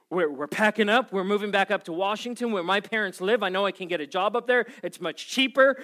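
freaking out. Retold or imitated. Husband telling his wife they're moving, frantic rush. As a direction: blurt out the plan in a rising panic. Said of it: "full freak out mode".

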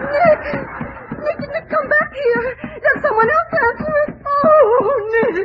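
high, tearful calls after him; crying. Acted. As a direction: call him back, sobbing. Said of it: "please stop crying".

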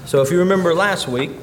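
ordinary talk, nothing marked

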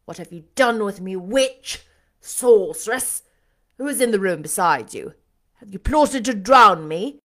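loud accusing outburst; angry demand. A narrator's reading. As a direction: fire off loud, outraged accusations. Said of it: "he demanded".

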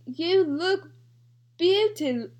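slow mock British accent; tentative try at a British accent. Attempting the tweet for a co-host, mock British accent. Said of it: "our renditions of British people".

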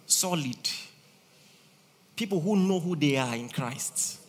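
ordinary talk

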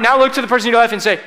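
ordinary delivery

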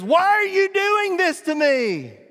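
high, loud, drawn-out wail; hammed-up doggy protest, slow and high; betrayed dog. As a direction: cry out like a pet being held down. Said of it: "she looks at me and she goes".

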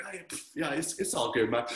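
low, soft retold self-talk; self-reassuring. Preacher retells justifying himself in his heart, quiet self-reassurance. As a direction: brush it off under the breath, imitating self-justifying. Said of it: "I kept telling myself".

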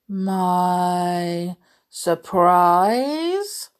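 very slow storybook beast, rising at the end; Beast's surprise. A narrator's reading. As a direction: stretch both words into a doubtful question. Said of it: "asked the beast".

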